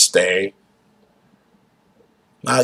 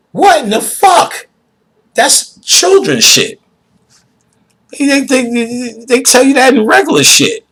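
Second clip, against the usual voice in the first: much higher, louder disbelief; can't believe it, pitched way up; incredulous rant; 4 dB louder than usual. Real, not acted.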